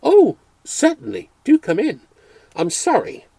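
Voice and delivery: high flustered babble; babbling. A narrator's reading. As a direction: tumble out the words. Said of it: "I babbled".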